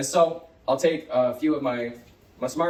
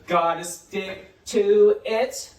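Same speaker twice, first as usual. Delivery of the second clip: loud, drawn-out "Gotta stick to it"; slow modelling; 4 semitones higher, 5 dB louder, much slower than usual. Shown as a demonstration. Teacher models "Gotta stick to it" for pupils, very slowly. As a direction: stretch every word of "Gotta stick to it".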